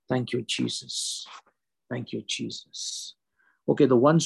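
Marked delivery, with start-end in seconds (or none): 0.1-1.3 softly thankful prayer. Real, not acted. 1.9-3.1 hushed thankful prayer. Real, not acted.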